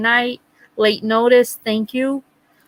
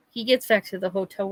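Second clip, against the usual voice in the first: ordinary talk, nothing marked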